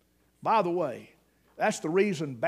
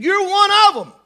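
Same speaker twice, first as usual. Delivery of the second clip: high, loud pointed accusation; accusing shout; 12 semitones higher, 10 dB louder than usual. Acted. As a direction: jab the finger, call him out. Maid accusing Peter by the fire.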